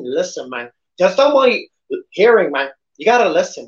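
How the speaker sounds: lightly done Tony Montana tough guy; a hammed-up Scarface impression. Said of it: "that's my, my Scarface voice".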